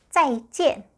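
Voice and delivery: 再见 whispered as zàijiàn, two falling tones; breathy whispered 再见 demo; Chinese 再见. Shown as a demonstration. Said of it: "안녕히 가세요". (再见을 속삭이듯 4성 두 번)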